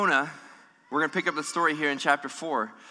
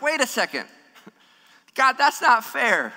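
indignant protest; 6 semitones higher than usual. Pretend. Imagined churchgoer protesting forgiveness.

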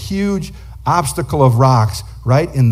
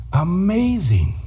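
awed; 4 semitones higher, slower than usual. A narrator's reading. Awe at rescue.

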